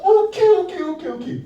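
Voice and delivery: loud high excited okay. Real, not acted. Teacher hyping up the children, loud and excited.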